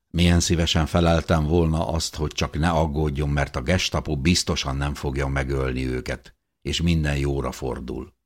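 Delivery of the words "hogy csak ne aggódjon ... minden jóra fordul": ordinary delivery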